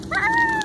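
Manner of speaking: sudden high shrieking cry; high startled scream. Pretend. Horse screams, startled by something, high and sharp. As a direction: shriek in alarm.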